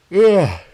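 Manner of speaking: loud high yawn sound; yawn groan. Real, not acted. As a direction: let out a big yawning groan. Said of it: "I apologize, I'm not tired".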